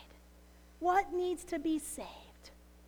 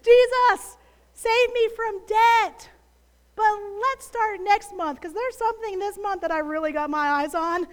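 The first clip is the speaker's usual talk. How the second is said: mock bargaining prayer; 2 semitones higher, 7 dB louder than usual.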